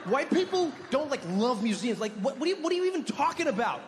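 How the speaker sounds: high, indignant imitation of an offended friend; offended, with conviction. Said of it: "said it with such confidence".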